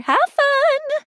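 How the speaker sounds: high, singsong farewell call; cheery send-off. Acted. Calling a send-off to two characters.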